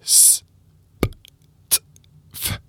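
s, p, t, f with no voice at all; voiceless sounds. Shown as a demonstration. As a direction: hiss out s, p, t, f, voiceless. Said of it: "It's just s".

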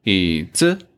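子 rises above 椅; neutral tone demo. Shown as a demonstration.

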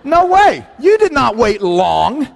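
high, slow disbelieving scoff; high incredulous no way; mock indignant. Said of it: "I didn't actually say that".

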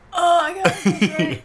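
sputtering as drink goes up nose; choking a little on a sip; drink in sinuses. Real, not acted. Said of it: "I'm not allowed to talk while I drink this".

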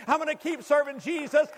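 usual speaking voice

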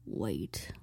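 dropped to a low whisper; a sudden hushed suspicious whisper; whispered wait. Retold or imitated. Grandma realizes what she's doing, a low hushed whisper. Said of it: "my grandma would come up to me and be like".

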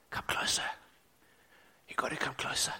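unvoiced hush; soft whispered invitation; gentle whisper. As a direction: whisper it like God close to the ear. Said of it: "gentle whisper".

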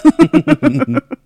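loud, high burst of laughter; real laugh. Real, not acted. Laughing at her own story.

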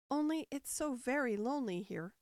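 plain reading voice

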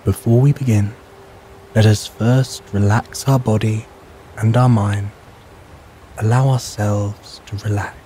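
ordinary delivery

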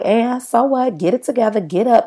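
mock a tough inner critic barking orders; harsh self-talk. Mocking how we scold ourselves when exhausted, harsh. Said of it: "we like".

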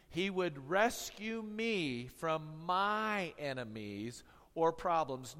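ordinary talk, nothing marked

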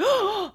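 high, loud imitated gasp; frightened gasp. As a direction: gasp like a scared child caught out. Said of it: "she was so scared".

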